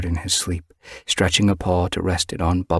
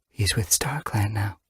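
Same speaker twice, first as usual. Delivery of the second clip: soft whisper of comfort; 3 semitones higher, 5 dB quieter than usual. Acted. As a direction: breathe it out gently. Grieving cat's soft comfort.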